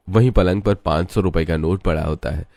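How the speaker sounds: ordinary delivery, nothing marked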